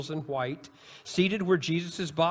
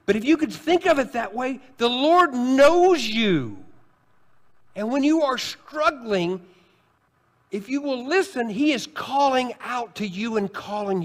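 louder, rising preacher's push; preaching at full force; 8 semitones higher, 6 dB louder than usual. Acted. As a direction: drive it home from the pulpit. Preacher builds again.

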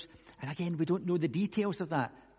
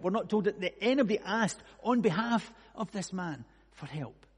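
ordinary delivery

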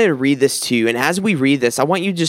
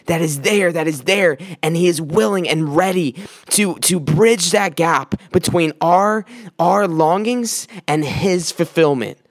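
rising preaching climax; 4 semitones higher than usual. Real, not acted. Preaching builds.

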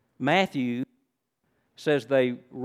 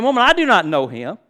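loud, higher-pitched protest; emphatic denial; 5 semitones higher, 8 dB louder than usual. Acted. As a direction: deny it forcefully as Peter. Acts Peter's emphatic denial.

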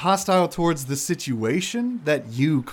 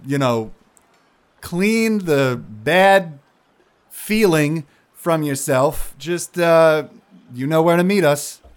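louder, slow careful coaxing; Longgrass's voice; 4 semitones higher, 5 dB louder than usual. Acted. Longgrass coaxing Zandros to follow later. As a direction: talk him down gently but firmly. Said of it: "Longgrass is like".

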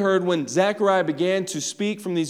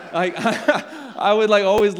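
laughing; 5 semitones higher, 7 dB louder, slower than usual. Real, not acted. Laughing at pastor's impression.

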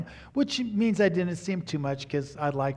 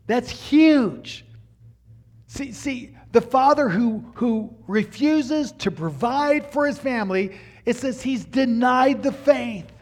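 1 fervent preaching; 8 semitones higher, 6 dB louder, slower than usual. Real, not acted. Preacher driving home scripture.

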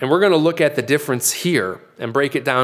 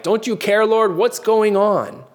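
higher, pleading cry to the Lord; anxious complaint; 7 semitones higher than usual. Retold or imitated. Believer asking the Lord amid trouble, pleading higher. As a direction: whine like a worried believer.

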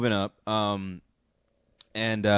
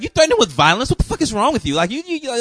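higher, louder accusing barrage; angry confrontation; 12 semitones higher, 7 dB louder, faster than usual. Retold or imitated. Girlfriend confronts him over the threat, high and angry. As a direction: fire repeated questions at him, much higher. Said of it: "just goes off on me".

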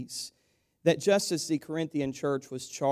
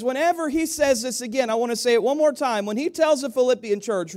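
rising, louder preacher build; preaching; 9 semitones higher, 6 dB louder than usual. Acted. Pastor lifting the church with rising fervour.

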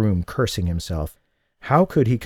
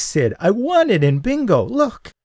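high, loud excited squeal; giddy high-pitched squeal of a bingo winner; 12 semitones higher, 3 dB louder than usual. A narrator's reading. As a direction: squeal it out, overjoyed. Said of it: "she squealed".

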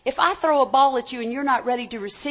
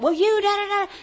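higher, snippy mock comeback firing back; mock retort; 8 semitones higher than usual. Shown as a demonstration. Pastor mocks a defensive spouse snapping back. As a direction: parrot a petty spouse's retort. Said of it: "No, don't do that".

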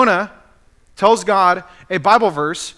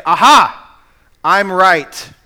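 louder gotcha cry of being right; smug triumphant exclaim; 9 dB louder, slower than usual. Acted. Commentator mimicking a smug reader, triumphant and louder. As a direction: crow it like you caught someone out.

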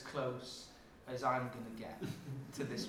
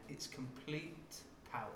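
usual speaking voice

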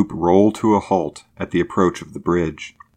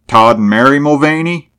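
higher, louder questioning tune on "Todd and Mary Mulvaney"; 7 semitones higher, 6 dB louder than usual. A narrator's reading. Old man checks the parents' names, questioning "Todd and Mary Mulvaney". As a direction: lift "Todd and Mary Mulvaney" into a raised query. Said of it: "questioned the oldster".

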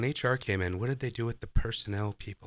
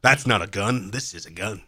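mock Australian accent, first half louder; Crocodile Dundee; 5 dB louder than usual. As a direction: quote it in a put-on Aussie accent. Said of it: "he's talking like Crocodile Dundee now".

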